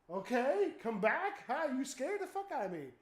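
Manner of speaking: startled calling out. Retold or imitated. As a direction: call after it, still rattled. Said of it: "I'm like".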